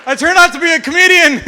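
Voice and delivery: high loud declaration; shouted punchline. Real, not acted. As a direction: announce it loudly to the crowd.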